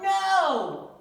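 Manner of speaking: very loud, high shout; yelled. Acted. Family yelling at the cashier, loud shout. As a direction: shout it all together. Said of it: "all yelled".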